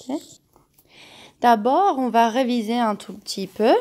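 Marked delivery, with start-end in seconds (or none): none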